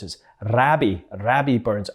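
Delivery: Rabbie with a short flat a, not Robbie; Scottish Rabbie. Shown as a demonstration. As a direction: stress Rabbie, the Scots way. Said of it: "we don't call him in Scotland Bobby Burns or Robbie Burns".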